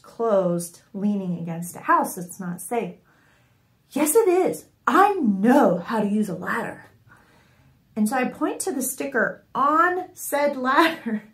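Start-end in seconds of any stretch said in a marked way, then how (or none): 3.9-6.8 hammed-up imitation of a know-it-all husband; mocking husband voice.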